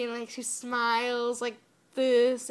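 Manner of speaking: normal talking voice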